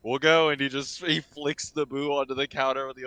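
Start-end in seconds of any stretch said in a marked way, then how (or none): 0.0-0.5 loud careless goodbye. Acted. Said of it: "he's like".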